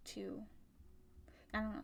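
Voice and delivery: ordinary talk, nothing marked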